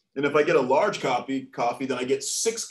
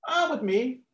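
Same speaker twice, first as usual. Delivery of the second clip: lightly voiced teenager, pitched up; a higher-voiced teen answering the police; 9 semitones higher than usual.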